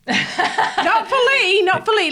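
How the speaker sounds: high, slow protest through laughter; laughing objection. Real, not acted. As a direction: cut in laughing, pitched well up. Teasing correction while laughing.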